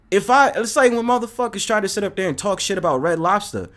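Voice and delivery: usual speaking voice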